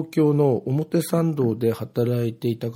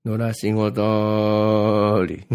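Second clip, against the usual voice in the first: slow, stretched Ibaraki dialect, a little louder; drawn-out Ibaraki dialect; 5 dB louder, much slower than usual. Retold or imitated. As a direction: drawl it out like an Ibaraki farmer. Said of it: "つぶやいてます。". (ゆっくり伸ばした茨城弁)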